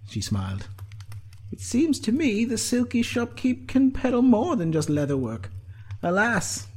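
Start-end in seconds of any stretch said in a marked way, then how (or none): 1.5-6.7 high-pitched woman's figure, musing; Sinead's character voice. A narrator's reading. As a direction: think it in a high voice.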